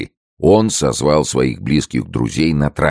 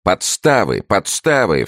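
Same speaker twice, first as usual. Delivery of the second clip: high-pitched, urgent exclamation; urgent cry; 8 semitones higher than usual. A narrator's reading. Friend urging the ruined man to flee, urgent cry. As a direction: call urgently for fresh horses. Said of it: "вскричал Пелесон".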